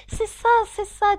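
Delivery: very high, loud plaintive agreement; sighing agreement. A narrator's reading. Gryphon sighs along with the Mock Turtle, plaintive. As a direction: wail agreement in turn. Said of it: "en soupirant à son tour".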